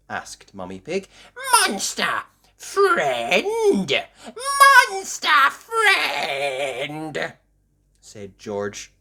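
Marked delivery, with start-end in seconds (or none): 1.7-7.3 put-on high, loud toddler cheer, drawn out; George's excited calls. A narrator's reading. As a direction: stretch the words out in high-pitched delight. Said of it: "jumping up and down".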